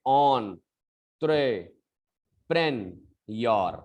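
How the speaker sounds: "entrepreneur" slowly, starting ON; correct pronunciation. Shown as a demonstration. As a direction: separate "entrepreneur" as on... tre... pren... yor. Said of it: "This is how to pronounce this word".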